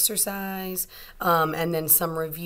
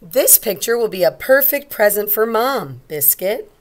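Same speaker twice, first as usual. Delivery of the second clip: put-on higher, louder, sing-song girl; bright call to the puppy; little girl voice; 4 semitones higher, 9 dB louder than usual. Teacher voicing the little girl calling Biscuit, high and cheerful.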